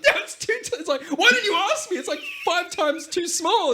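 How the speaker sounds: high, loud mock tantrum; hammed-up high angry rant; freaking out. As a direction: whine it up high, outraged. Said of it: "he freaking out at Bra Man".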